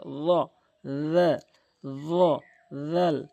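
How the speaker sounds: র said with rounded lips, then জাল with spread lips; contrast demo. Shown as a demonstration. Teacher shows students the lip shape of each letter. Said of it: "রটি মুখ গোল".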